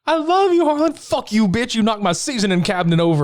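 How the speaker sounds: high, swinging from sweet to cursing; hammed-up high-pitched lovers' spat; mock lover's rant. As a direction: squeal the love then snap the curse. Acted quarrel.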